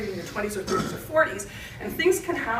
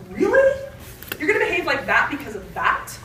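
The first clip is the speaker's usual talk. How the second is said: mock disbelief; 4 semitones higher, 5 dB louder than usual. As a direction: imitate an exasperated, incredulous adult. Imitates adult reacting to child's behavior.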